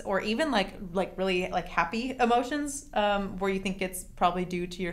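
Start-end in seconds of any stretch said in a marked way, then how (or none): none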